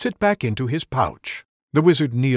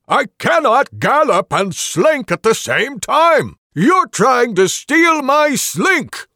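high, loud, slowed outrage; indignant protest; 13 semitones higher, 10 dB louder, slower than usual. Acted. Rogue standing in the wagon defending his slink, indignant. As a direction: glare down and protest.

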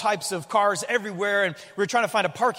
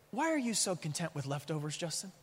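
much softer, gentle question; quiet inner voice; 4 semitones lower, 11 dB quieter than usual. Retold or imitated. Holy Spirit gently questioning the pastor, soft. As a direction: ask it softly, like a nudge inside. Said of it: "the Holy Spirit just speaks to me".

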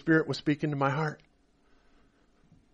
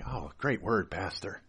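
hoped-for praise; 7 semitones lower, 11 dB quieter than usual. Retold or imitated. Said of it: "I was hoping that I would get some praise like".

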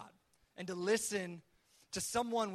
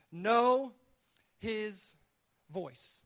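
each word alone with a long pause, falling to "voice"; emphatic, spaced-out point; 4 dB louder, much slower than usual. Real, not acted. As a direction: hammer KNOW, let "his voice" settle slowly. Preacher repeats his first point.

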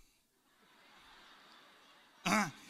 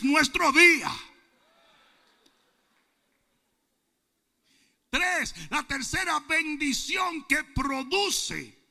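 loud preaching, slowing at the end; full-force preaching; 5 semitones higher, 8 dB louder, slower than usual. Real, not acted. Preacher proclaiming to the congregation, loud preaching. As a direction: preach it to the rafters.

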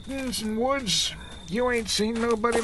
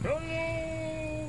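eerie hail; 6 semitones higher, 8 dB quieter than usual. Acted. Hitchhiker calls out again.

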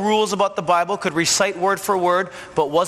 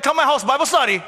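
much higher, louder chirpy invitation; eager invite; 9 semitones higher, 3 dB louder than usual. Retold or imitated. Inviting a neighbor to Bible study, much higher and louder. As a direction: blurt the invite with cheerful eagerness. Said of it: "You're like".

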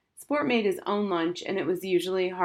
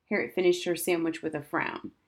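usual speaking voice

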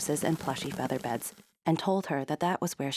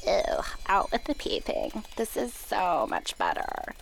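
whispery, airy fairy voice; flying fairies approving; 4 semitones higher than usual. A narrator's reading. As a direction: breathe it like a tiny fairy. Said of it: "said the flying fairies".

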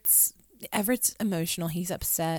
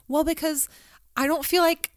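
imitating hurt friend; 9 semitones higher, 5 dB louder, faster than usual. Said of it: "And she would say".

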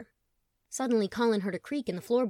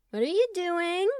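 forced casual call; 10 semitones higher, 4 dB louder than usual. Acted. Tom calls to brother.

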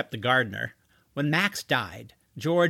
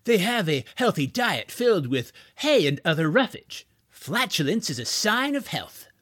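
lightly voiced smooth salesman; superficial salesman charm; 4 semitones higher than usual. A narrator's reading. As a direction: turn on a touch of sales charm. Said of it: "turning on the superficial charm".

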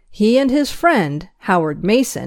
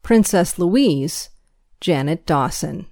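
ordinary delivery

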